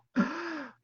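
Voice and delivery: sharp, high intake of air; imitation of a desperate, drowning gasp; imitated gasping breath. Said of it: "It took a breath".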